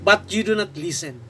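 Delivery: slow, emphatic Bible reading; drawn-out questions to God, falling away at the end; scripture lament. Acted. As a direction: declaim the lament phrase by phrase. Reads Habakkuk's lament aloud.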